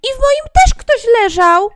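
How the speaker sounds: very high, loud astonished cry; surprised dwarf. Acted. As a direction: gasp it out in amazement like a dwarf. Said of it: "ze zdziwieniem". (zdziwiony, wysoki okrzyk)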